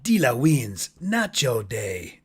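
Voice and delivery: slow, stretched Cajun drawl; imitating Gambit's Cajun catchphrase; Gambit's Cajun accent. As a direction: quote Gambit from the old game. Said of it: "Gambit used to say".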